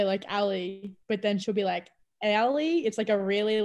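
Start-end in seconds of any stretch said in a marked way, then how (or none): 2.2-2.8 southern accent imitation. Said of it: "really southern way of saying it".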